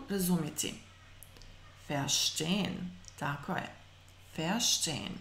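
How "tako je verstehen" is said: ordinary talk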